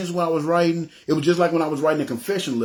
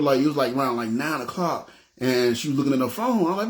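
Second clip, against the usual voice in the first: usual speaking voice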